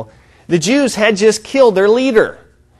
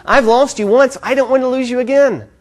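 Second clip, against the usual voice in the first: raised pitch, mildly urgent plea; acted clinging appeal, pitched up; imagined pleading; 7 semitones higher than usual. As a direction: plead a little, voice lifted high. Mary clinging to Jesus, imagined.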